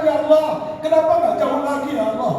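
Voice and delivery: high imitated lament; tearful plea. Dying husband calls on Allah, high tearful plea. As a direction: plead upward, dropping away at the end. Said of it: "dia menangis".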